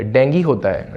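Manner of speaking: normal talking voice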